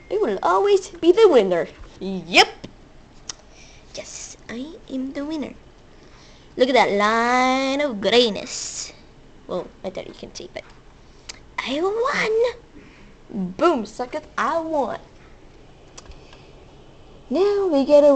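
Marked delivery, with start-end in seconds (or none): 3.9-5.5 gloating whisper; hushed smug whisper. Real, not acted. Gloating under breath. 11.6-13.5 hushed triumphant whisper; quiet gleeful whisper. Real, not acted.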